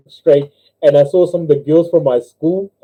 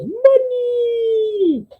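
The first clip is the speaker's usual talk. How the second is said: long falsetto whoop; gleeful slide cry; 4 dB quieter than usual. Acted. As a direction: squeal it sliding down, stretched out. Acting man shouting down magic slide.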